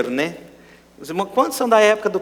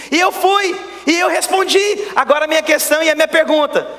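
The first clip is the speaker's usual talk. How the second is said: preaching shout; 7 semitones higher than usual. Real, not acted. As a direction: build the testimony to a ringing peak. Pastor rising to a peak.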